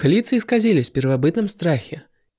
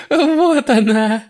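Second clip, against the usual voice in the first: high gleeful cackle into the words; laughing; 10 semitones higher, 4 dB louder, much slower than usual. Acted. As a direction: laugh wildly while announcing. Villain goddess reveals the sack.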